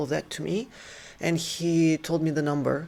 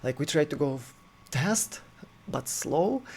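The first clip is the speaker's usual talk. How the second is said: usual speaking voice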